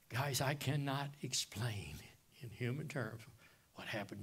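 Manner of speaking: soft, breathy near-whisper; hushed awe. Real, not acted. Preacher telling the church of his conversion in a whisper. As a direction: hush it down in wonder.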